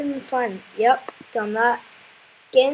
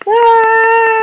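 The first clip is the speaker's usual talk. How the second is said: long, high, loud yell; drawn-out gamer scream; 10 semitones higher, 12 dB louder than usual. Real, not acted. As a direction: hold one loud, high "ah". Screams during the game fight.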